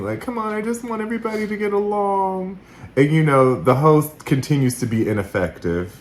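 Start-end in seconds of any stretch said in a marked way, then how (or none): 0.2-2.6 high, soft, hurried imitation of pleading; mock tearful pleading. Host imitating a crying woman begging for peace, mock pleading. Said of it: "is just crying and pleading".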